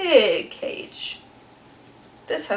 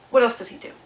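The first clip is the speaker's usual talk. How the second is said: ordinary delivery, nothing marked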